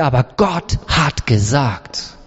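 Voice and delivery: low, slow, grave reply; calm firm answer. Acted. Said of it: "Und er sagte". (tief, langsam und bestimmt)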